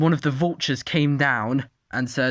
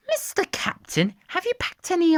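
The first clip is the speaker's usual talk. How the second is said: squeaky high Penny voice; high character voice. Acted. Penny asking the captain for crossbows, high squeaky voice. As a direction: pitch way up for a small creature.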